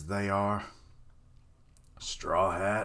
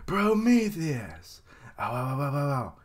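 mock pained cry; 4 semitones higher, 3 dB louder than usual. Prometheus crying out as he is cut, mock pain. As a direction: ham up the hurt monster.

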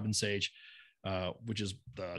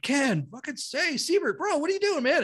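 much higher, delighted buddies calling out; excited greeting; 14 semitones higher than usual. Retold or imitated. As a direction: imitate friends surprised to see him. Old buddies greeting him, retold.

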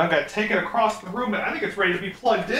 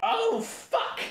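high startled curse; shocked outburst; 13 semitones higher than usual. Real, not acted. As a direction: yelp it in alarm. Lamp goes wrong suddenly.

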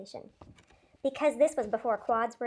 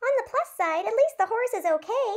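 higher, bright upbeat line; cheery optimism; 10 semitones higher, 4 dB louder than usual. A narrator's reading. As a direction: look on the bright side. Leno cheerfully looking on bright side.

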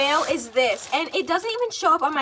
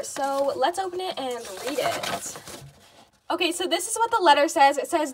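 ordinary delivery

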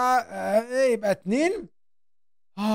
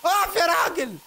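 higher, rising shouts of stop; panicked yelling; 7 semitones higher than usual. Real, not acted. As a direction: yell at the chaser to stop. Chased by the monster, yelling. (صراخ مذعور للوحش)

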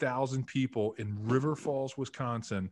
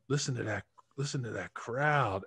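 put-on Alex Gibbs's Southern accent. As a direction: draw it out like a Southern coach. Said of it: "Southern North Carolina accent".

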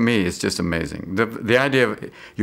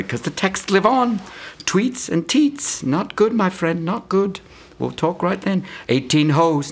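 much higher, slowed, teasing advice; high mock voice; 10 semitones higher, slower than usual. Mock advice to Tiger about texting, high voice. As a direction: sing it up high like a preachy pal.

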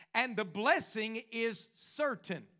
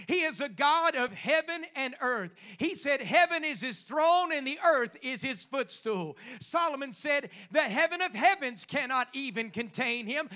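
preaching at full force; 2 semitones higher, 5 dB louder than usual. Acted. Preacher urging the congregation to trust God, fervent and loud. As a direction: rouse the room, build to a peak.

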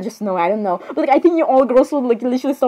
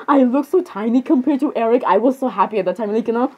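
usual speaking voice

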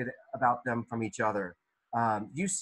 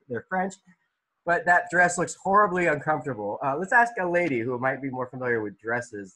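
normal talking voice

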